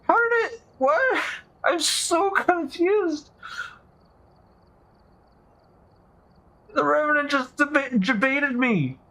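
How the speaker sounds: high frantic baffled questions; rattled and disbelieving, pitch way up; panicking gamer. Real, not acted. Panicking over the Revenant.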